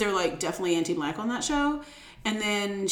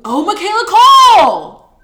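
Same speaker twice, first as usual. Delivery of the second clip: much higher and louder, slow triumphant shout; excited realization; 11 semitones higher, 15 dB louder, slower than usual. Real, not acted. Finally recalling the actress's name, excited shout. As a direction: shout the name like you just solved it.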